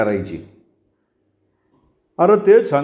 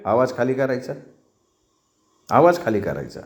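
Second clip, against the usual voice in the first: stern low warning; 4 semitones lower, 4 dB quieter, faster than usual. Acted. Pratap orders voice down.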